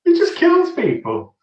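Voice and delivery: high, loud incredulous exclamation; mock outrage. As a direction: raise the voice in amused disbelief. Mock outrage at film plot.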